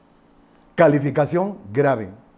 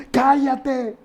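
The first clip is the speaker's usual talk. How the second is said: scolding; 10 semitones higher than usual. Acted. Crowd silencing the beggar once more.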